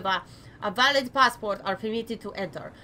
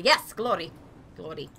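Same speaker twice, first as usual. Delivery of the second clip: usual speaking voice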